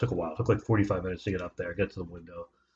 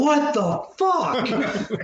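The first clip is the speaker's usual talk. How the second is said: very high, slow, laughing shriek; shocked wife; 13 semitones higher, much slower than usual. Retold or imitated. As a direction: drag it out in laughing disbelief after a sneeze. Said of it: "She's like".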